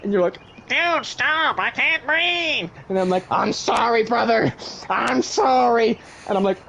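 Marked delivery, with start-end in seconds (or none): 0.7-2.7 high, drawn-out strangled plea; mock choking plea. Giant with his nostrils clamped shut, high choking plea. Gripping as hard as I can onto your nostrils. 3.3-6.0 acted apology. Apologising while clamping the nose.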